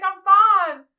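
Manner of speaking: put-on drunk insistence. Wife drunk in bed insisting to her husband, hammed-up "I'm fine". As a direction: overdo the brave "I'm fine". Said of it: "And she's like".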